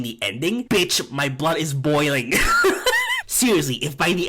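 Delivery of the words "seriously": high burst of laughter into the next word; laughing. Real, not acted. Laughing at himself while ranting to viewers. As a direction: laugh at your own anger.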